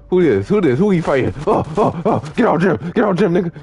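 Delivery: high, rapid-fire game panic; urgent calling out. Real, not acted. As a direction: call urgent orders to a teammate. Sudden gunfight in game, urgent calls.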